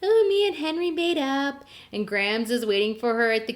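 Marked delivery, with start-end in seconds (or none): 0.0-1.6 high, giddy mock-girlish quote; giddy and glowy. Jen telling Grams about making up with Henry, giddy. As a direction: imitate a glowing teen, pitched way up. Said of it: "she's all giddy and glowy".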